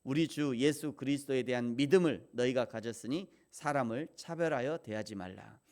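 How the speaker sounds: ordinary delivery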